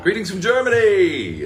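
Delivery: high, loud, drawn-out announcement; booming mock-grand hello; hearty shouted greeting. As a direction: call it out big and slow. Calls out a viewer's greeting.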